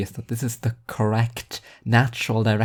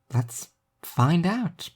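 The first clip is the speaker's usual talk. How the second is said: put-on slow, higher girl's voice; Alice's voice; 8 semitones higher, much slower than usual. A narrator's reading. Alice inviting her friend to break in, slow and higher. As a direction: drawl it out playfully as Alice.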